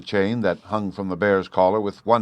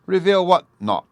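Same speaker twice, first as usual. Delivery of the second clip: irritable, much higher and louder; testy; 11 semitones higher, 6 dB louder than usual. A narrator's reading. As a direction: snap back in annoyance. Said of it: "her husband testily replied".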